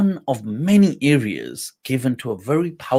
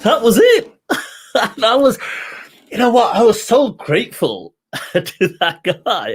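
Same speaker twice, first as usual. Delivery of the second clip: laughing relief; 7 semitones higher, 4 dB louder than usual. Real, not acted. Relief after the visa stamp.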